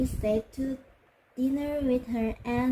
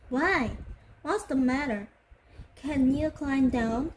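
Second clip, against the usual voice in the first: plain reading voice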